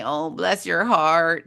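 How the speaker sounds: slow, sugary "Oh, bless your heart" meaning the opposite; sweet on top, a dig underneath; sarcastic bless your heart. Shown as a demonstration. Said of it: "that's a Southern girl's way of saying".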